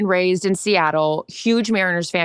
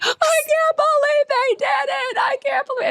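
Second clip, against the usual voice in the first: imitating mom crying. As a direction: mimic a mother weeping with joy at the win. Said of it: "She's crying".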